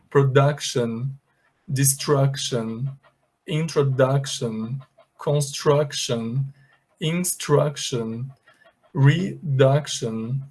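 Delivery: production to reduction, u like a short uh, c like k; slow model reading. Shown as a demonstration. Teacher slowly modelling the uction words. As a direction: drag out each word slowly. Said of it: "vocês vão pronunciar assim".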